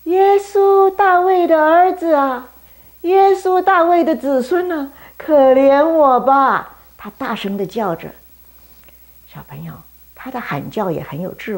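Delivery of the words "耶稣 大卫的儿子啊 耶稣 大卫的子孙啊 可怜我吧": high, loud, drawn-out plea; loud crying out. Acted. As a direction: cry out for mercy with everything. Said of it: "他就大声地叫着".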